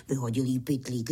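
ordinary delivery, nothing marked

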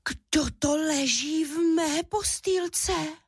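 high, slow squeak of amazement; astonished little cub voice; Míša. A narrator's reading. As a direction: squeak it in wonder, stretched out. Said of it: "vypískl udiveně malý medvídek Míša".

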